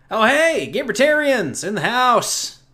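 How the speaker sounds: high, loud hyped greeting; excited shout-out. Real, not acted. Host greets fans joining the stream, loud and excited. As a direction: hype up the new arrivals.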